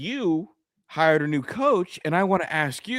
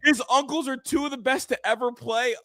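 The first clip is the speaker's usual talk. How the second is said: higher, savouring every word of the quote; gleeful repeat; 8 semitones higher than usual. Real, not acted. Cohost relishes quoting his partner's slip, gleeful. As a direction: replay his line with delighted disbelief.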